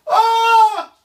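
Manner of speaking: high loud scream; screaming while falling. Real, not acted. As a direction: scream as you fall. Virtual fall reaction.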